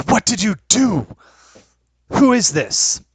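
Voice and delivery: high, sharp startled questions; shocked demand. Acted. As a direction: demand answers, alarmed. GM voices surprised NPC.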